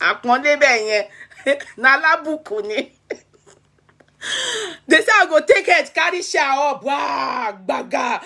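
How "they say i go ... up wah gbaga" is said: hammed-up toddler wailing with shouts; sobbing and yelling as a spoilt little girl, played for laughs; mock childish crying. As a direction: bawl it like a child throwing a fit. Acting out her childhood tantrum.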